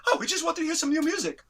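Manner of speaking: high-pitched eager pleading; Tommy. Retold or imitated. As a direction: imitate a friend's high voice. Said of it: "he had this high voice".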